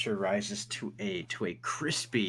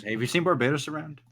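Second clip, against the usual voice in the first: eager question; 6 semitones higher, 7 dB louder, faster than usual. Acted. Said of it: "He goes".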